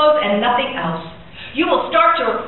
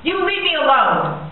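defiant command; 6 semitones higher than usual. Acted. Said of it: "only call him him or you".

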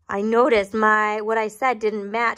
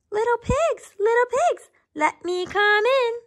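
very high, lilting wolf call; high, wheedling wolf at the door; storybook wolf; 12 semitones higher than usual. A narrator's reading. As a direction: coax them in a pitched-up singsong. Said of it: "said the wolf".

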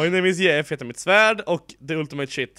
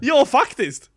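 excited laugh; 10 semitones higher, 7 dB louder, slower than usual. Real, not acted. Excited about skeleton farm idea.

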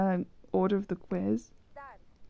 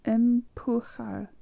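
"Empujar" spelled out slowly, every syllable drawn out; slow careful pronunciation; much slower than usual. Shown as a demonstration. Learner sounding out "Empujar" slowly for viewers. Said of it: "tomar means to take".

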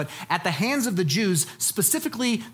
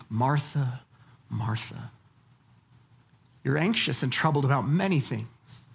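gentle; 9 semitones lower than usual. Retold or imitated. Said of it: "But the Lord answered her".

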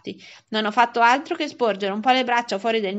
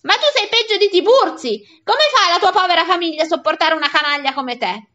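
high, loud tirade; acted outraged scolding; furious insults; 7 semitones higher, 8 dB louder than usual. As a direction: berate the rascal in exasperation. Angry man hurls insults at boy.

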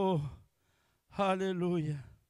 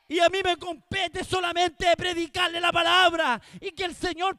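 loud, ringing pulpit cry; fiery Spanish sermon shout; full-force preaching; 5 semitones higher, 9 dB louder than usual. Acted. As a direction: preach it out to the whole congregation.

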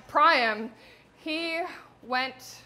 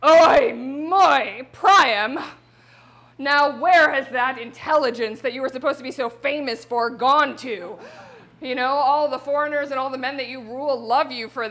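loud, pleading outcry at her husband; screaming wife; 7 dB louder than usual. Acted. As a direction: shout at him in disbelief and fear. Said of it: "she screamed".

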